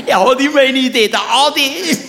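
laughing at Adi's antics. Real, not acted. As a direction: speak through the giggles. Laughing through story.

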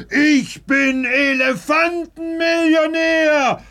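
high, loud elephant cheer; jubilant shouting. Acted. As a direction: shout your joy to the whole town. Trumpets his win.

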